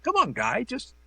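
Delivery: a touch of mock irritation; imitated snap. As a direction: mimic a star losing patience, half-playful. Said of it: "Myers kinda snap at somebody".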